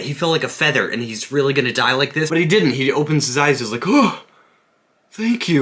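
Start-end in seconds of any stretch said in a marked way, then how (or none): 3.9-5.6 high-pitched imitation of a startled old man; old man's grateful gasp. Fallen old man thanking his helper, high mock-startled voice. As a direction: pipe up high, as if just coming round.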